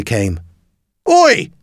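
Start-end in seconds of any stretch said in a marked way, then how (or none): 1.1-1.5 high, sharp call of an inspector; ticket inspector. A narrator's reading. Inspector challenging a boy on the bus, high indignant call. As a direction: call out high to stop someone. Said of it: "said the ticket inspector".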